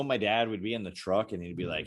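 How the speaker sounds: usual speaking voice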